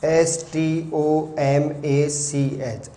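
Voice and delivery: each letter of stomach spelled out very slowly; very slow letter-by-letter dictation; slow spelling. Shown as a demonstration. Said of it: "stomach".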